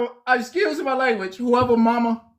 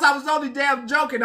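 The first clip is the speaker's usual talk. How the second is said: normal talking voice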